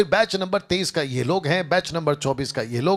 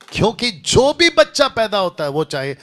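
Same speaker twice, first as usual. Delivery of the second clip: louder and higher, launching the point; preaching with a higher pitch; rising preacher voice; 7 semitones higher, 6 dB louder than usual. Real, not acted. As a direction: lift the voice as the sermon takes off. Preacher builds into the sermon.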